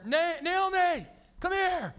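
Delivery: high, loud call across the room; dad calling his youngest from the recliner. Retold or imitated. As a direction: yell for the kid from the recliner.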